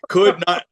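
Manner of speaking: emphatic repeat of the boredom. Real, not acted. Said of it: "could not be more bored".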